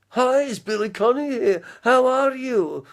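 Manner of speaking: exuberant greeting. Acted. Acting out a meeting with a famous comedian, exuberant greeting.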